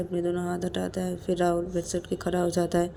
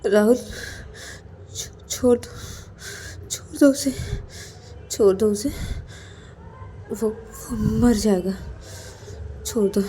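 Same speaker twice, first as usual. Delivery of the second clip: crying plea; 4 semitones higher than usual. Acted. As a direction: beg him to stop through tears. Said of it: "रोते रोते राहुल को बोलता कि".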